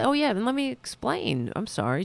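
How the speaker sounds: usual speaking voice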